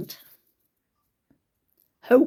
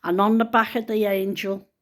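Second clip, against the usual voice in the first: ordinary talk, nothing marked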